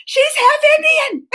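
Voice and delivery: high laughing shout; excited squeal. Retold or imitated. Telling viewers why she was thrilled, high laughing shout. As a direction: shout it out with glee. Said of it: "That's what excited me".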